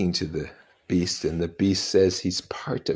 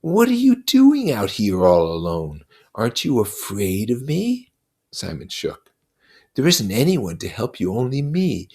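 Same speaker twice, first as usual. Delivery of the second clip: put-on a buzzing devil taunting a child; Lord of the Flies, mocking; 6 semitones higher, 7 dB louder than usual.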